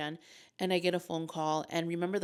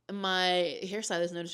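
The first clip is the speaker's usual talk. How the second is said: normal talking voice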